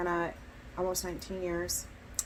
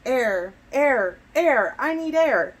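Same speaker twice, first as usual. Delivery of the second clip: louder, higher repeated cries building; urgent poetic plea; 4 semitones higher, 10 dB louder, slower than usual. Acted. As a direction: build the repetition into a pleading call for air. Poem builds to needing air.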